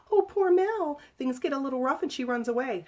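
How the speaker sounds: mock her in a whiny squeak; mocking high voice. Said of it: "He sneered with a mocking high-pitched voice".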